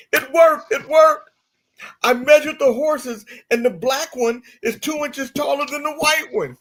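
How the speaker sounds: high, loud gleeful shouting; overjoyed. Acted. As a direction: burst in like a lottery winner. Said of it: "as if he just won the lottery".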